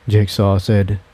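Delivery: usual speaking voice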